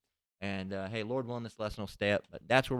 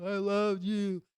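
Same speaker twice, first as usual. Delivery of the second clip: high, drawn-out mock wail; mock crying; 9 semitones higher, 4 dB louder, slower than usual. Movie man crying over his dying lover, mock tearful. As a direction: imitate a weepy, slow lament. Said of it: "one will be crying".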